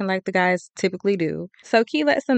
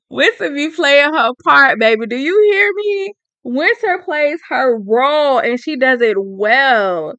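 laughing, then much higher and louder; hyped-up praise with a laugh; laughing hype; 4 semitones higher, 5 dB louder than usual. Real, not acted. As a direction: gush over her, laughing.

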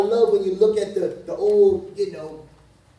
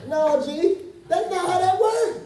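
high-pitched amused objection; squeaky-high playful "not how that works"; mock protest; 11 semitones higher than usual. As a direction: push back in a much higher, comic voice. Mock-protesting counselee's deflection.

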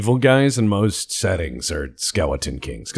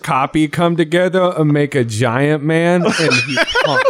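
mock broken English; 4 semitones higher than usual. Mock broken-English narration, laughing.

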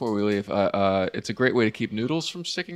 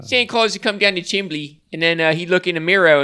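put-on loud, higher Baltimore accent on chimbley; 6 semitones higher, 4 dB louder than usual. Mocking Baltimore locals for the podcast crowd, in a Baltimore accent. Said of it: "a voice that can take the paint off your car".

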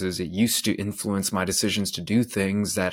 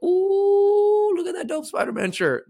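mock awe; 6 dB louder than usual. Imagined classmates seeing his shirt, high-pitched mock awe. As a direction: squeal like kids admiring something cool.

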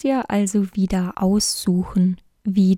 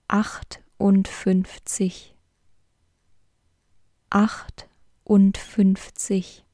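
whispered counting. Acted. Teacher to learner practising numbers, whispered counting.

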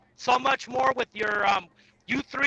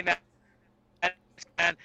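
ordinary talk, nothing marked

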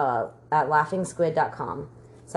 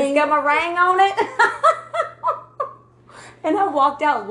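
punchline bursting with laughter, higher and louder; laughing; 10 semitones higher, 7 dB louder than usual. Real, not acted. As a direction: laugh it out as you deliver the punchline. Laughing retelling the joke punchline.

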